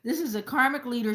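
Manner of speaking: normal talking voice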